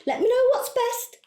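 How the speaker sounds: very high, sing-song politeness; put-on sweetness. Client ending message to coach, falsely sweet and high. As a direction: chirp it sweetly to hide irritation.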